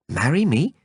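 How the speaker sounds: disbelieving echo of his words; shocked, incredulous. Acted. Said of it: "in a shocked, incredulous voice".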